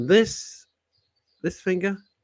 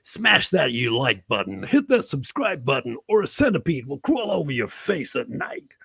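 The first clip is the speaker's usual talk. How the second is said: mock American YouTuber. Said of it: "How many American things have I seen?".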